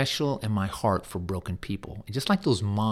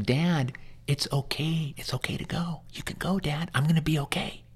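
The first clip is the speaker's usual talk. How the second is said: tender reassurance; 6 semitones higher than usual. Retold or imitated. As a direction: ease him gently, letting him go. Grown child releasing dying father.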